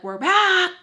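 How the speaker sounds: high, loud whoop; imitating friends' wild arrival holler; rowdy entrance shout. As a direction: burst in yelling like crazy. Friends burst in, crazy.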